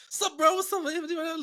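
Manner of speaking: very high-pitched mock homie greeting; hammed-up squeaky street slang. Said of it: "you met your homies, you be like".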